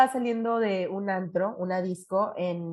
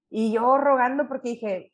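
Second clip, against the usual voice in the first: ordinary talk, nothing marked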